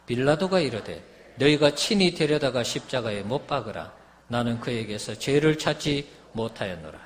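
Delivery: loud, declamatory Bible reading; forceful scripture reading. A narrator's reading. Preacher reading Pilate's trial to the congregation, loud and declamatory.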